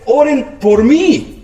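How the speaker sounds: imitación aguda y lenta de un creyente quejoso; creyente que pide oración. Retold or imitated. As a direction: ruega alargando cada palabra, en tono alto. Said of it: "cuando la gente nomás dice". (imitando a quien pide oración, voz aguda y arrastrada)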